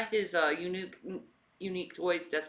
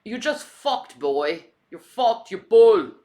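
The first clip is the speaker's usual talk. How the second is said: hammed-up British accent, higher and louder; mock British insult; 6 semitones higher, 10 dB louder, slower than usual. Jeering at the absent influencer for fun, in a hammed-up British accent. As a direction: jeer like a rowdy British lad. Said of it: "I just like doing accents".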